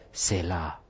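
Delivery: put-on "Selah" said low and soft, drawn out with a grand foreign accent; heavily accented Selah. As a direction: intone "Selah" deep and hushed like a mock chant. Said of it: "but with a lot of accent".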